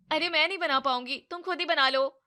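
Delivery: rude brush-off. Acted. Dismissive retort to father-in-law.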